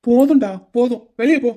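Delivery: loud order to get out; angry dismissal. Acted. Groom ordering his friend out of the room, angry. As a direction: throw him out of the room.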